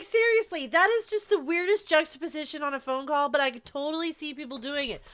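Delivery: normal talking voice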